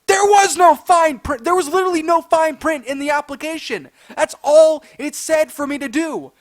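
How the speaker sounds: retold shouted protest; outraged arguing. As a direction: shout down the manager's claim. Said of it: "trying to argue and reason".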